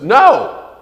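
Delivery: high loud cry of no; mother's alarm shout. Retold or imitated. Mother seeing the truck coming, shouting no in alarm. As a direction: yell no as the truck comes. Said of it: "She says".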